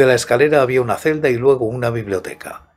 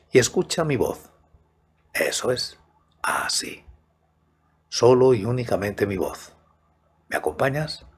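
right in your ear; 5 dB quieter than usual. Acted. Invites listener to hear only voice.

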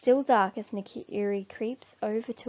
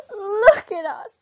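much higher, louder, slow wail; cry of dismay; 12 semitones higher, 10 dB louder, slower than usual. A narrator's reading. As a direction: cry out in horror. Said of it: "they cry in dismay".

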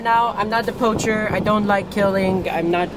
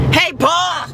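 high mock holler for Paw; calling out; 10 semitones higher, 3 dB louder than usual. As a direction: holler across the farm, pitched way up. Calling Paw as farm kid.

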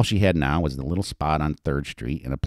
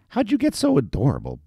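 high-pitched flirting voice; flirtatious; 10 semitones higher than usual. A narrator's reading. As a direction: flirt playfully with him. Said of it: "Betty flirted endlessly as they walked".

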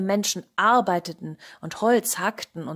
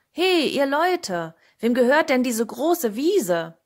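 loud, raised, drawn-out hail; calling out; 5 semitones higher, 8 dB louder, slower than usual. A narrator's reading. Cat asks field workers who owns it, calling out. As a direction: call across a field to a crowd. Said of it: "Er rief den Leuten zu".

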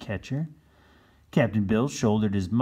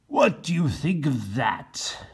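Captain Bill; 6 semitones higher, 5 dB louder than usual. Acted. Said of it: "said Captain Bill".

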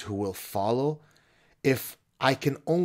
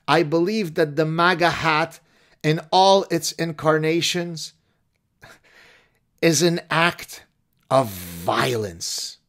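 mock-tearful, higher and louder, dragging words slowly; overwrought letter writer; 7 semitones higher, 7 dB louder than usual. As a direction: ham up a distraught student on the edge of tears.

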